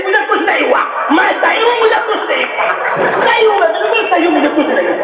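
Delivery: put-on high girlish protest with a laugh; imitating the girl. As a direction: play the girl pleading she's not possessed. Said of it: "कह रही".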